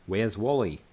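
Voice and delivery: a light put-on Australian accent on the name; mock Australian accent. Saying the Australian name for Where's Waldo, in a light Australian accent. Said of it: "That sounds like an Australian".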